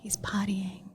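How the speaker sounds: soft unvoiced whisper. Real, not acted. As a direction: hush it so he can't hear. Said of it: "Why are you whispering?".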